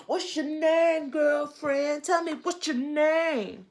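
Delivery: slow, drawn-out spelling of the name. Retold or imitated. Vlogger imitating a singer's spoken intro, slow and drawn out. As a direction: lightly mimic a soul singer's spoken intro.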